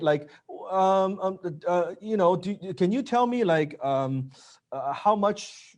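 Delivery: high, hesitant, stumbling ask with lots of ums; timid rep too afraid to ask for revenue; nervous closer. Retold or imitated. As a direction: fumble the question like a scared salesman. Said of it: "I've seen closers, they talk like that".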